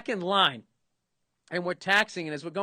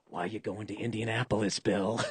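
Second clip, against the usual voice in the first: creepy low question; 8 semitones lower, 4 dB quieter than usual. Retold or imitated. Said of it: "I just hear".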